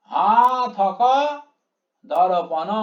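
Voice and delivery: long "হা", then "থ ক দর্পণ" in open syllables; verse pronunciation. Shown as a demonstration. Teacher modelling the line's pronunciation, measured syllable by syllable. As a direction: stretch "হা", count out "দর্পণ" syllable by syllable. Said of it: "উচ্চারণটা এরকম আসবে".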